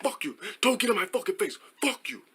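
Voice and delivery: high, muted imitated comeback; mock arguing. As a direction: fire back in a put-on high voice. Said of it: "we were just going back and forth".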